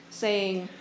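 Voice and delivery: ordinary delivery, nothing marked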